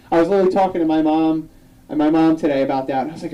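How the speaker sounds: normal talking voice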